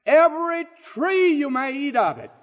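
high ringing declaration, stretched out; high, sweeping pulpit proclamation; God's generous proclamation. Retold or imitated. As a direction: proclaim it high, as God granting a gift. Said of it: "God says to them".

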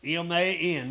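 letters M A N spelled out for emphasis. Shown as a demonstration. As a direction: spell out M A N, one letter at a time. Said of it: "put emphasis on that last word".

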